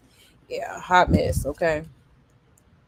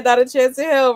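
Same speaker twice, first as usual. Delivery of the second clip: mock quote; 5 semitones higher, 5 dB louder than usual. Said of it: "He said".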